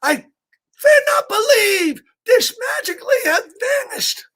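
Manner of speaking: high crying shout of a frantic chef; chef's tantrum. Acted. Reading to kids, the chef wails in a tantrum. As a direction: wail it out like a tiny chef losing it. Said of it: "the chef throws quite a tantrum".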